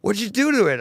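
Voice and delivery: high and loud, stunned; astonished question. Retold or imitated. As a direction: demand it in amazement. Client reacting to painted bike.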